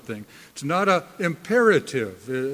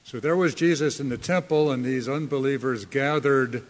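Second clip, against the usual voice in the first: usual speaking voice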